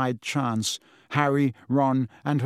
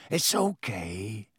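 slow, low, soft reassurance; soft, soothing; 4 semitones lower, 4 dB quieter, slower than usual. A narrator's reading. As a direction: settle a nervous animal, slowly and gently. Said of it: "said Hagrid softly".

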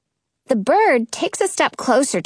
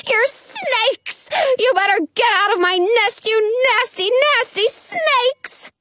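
high, scolding bird, rising to a screech; angry pigeon; 8 semitones higher than usual. A narrator's reading. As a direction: build from disbelief to shrill accusation. Angry pigeon accuses the girls.

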